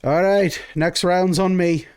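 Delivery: rally the table to drink; cheerful tavern shout. Acted. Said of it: "you hear".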